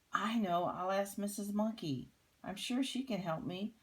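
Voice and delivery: eager storybook kangaroo mother; sudden bright idea. A narrator's reading. Katie hits on an idea.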